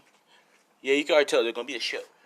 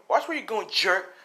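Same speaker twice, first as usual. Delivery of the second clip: mildly annoyed, higher-pitched jab; acted snap at someone bumping you; pitched-up acted irritation; irritated call-out; 8 semitones higher than usual. Mitsuki's line redone with new insult.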